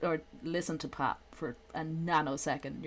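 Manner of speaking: usual speaking voice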